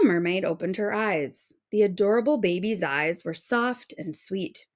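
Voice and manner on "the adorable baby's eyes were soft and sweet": ordinary delivery, nothing marked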